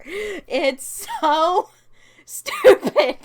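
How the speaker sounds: amused incredulous laugh; laughing disbelief. Real, not acted. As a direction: crack up at how dumb it is. Laughing at silly episode.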